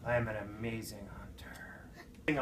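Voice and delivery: slow hushed whisper; whispered boast. Retold or imitated. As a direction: breathe the boast, slowly. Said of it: "he goes".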